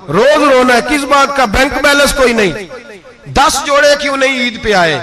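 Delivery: preaching at full force against complaints; building preaching. Acted. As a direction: hammer each complaint home.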